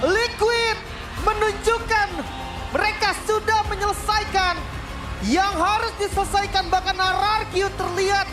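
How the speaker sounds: high, slow, ecstatic caster call; excited victory call. Acted. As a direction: soar over the winning moment. Caster calls the clean sweep.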